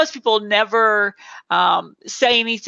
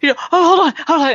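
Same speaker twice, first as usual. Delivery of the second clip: higher, breathless hold-on; mock breathless panic; 7 semitones higher, 4 dB louder, much faster than usual. Imitating a guest hyperventilating mid-talk, mock breathless. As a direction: act out a flustered speaker catching her breath. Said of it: "she starts to hyperventilate".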